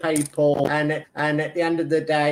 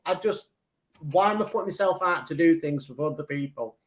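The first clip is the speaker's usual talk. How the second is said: ordinary delivery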